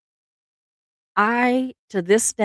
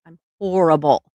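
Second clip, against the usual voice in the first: usual speaking voice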